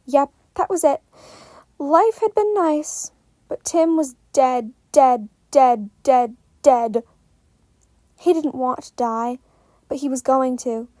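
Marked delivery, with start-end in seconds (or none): none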